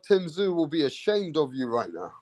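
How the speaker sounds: ordinary delivery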